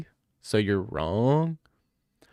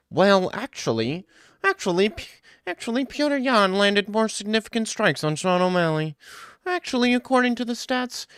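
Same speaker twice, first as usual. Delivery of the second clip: mock stats nerd; 9 semitones higher than usual. Mocking a stats nerd who quotes numbers, high and smug. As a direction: play the smug stats nerd correcting everyone. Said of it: "you're a nerd who doesn't know how fighting works".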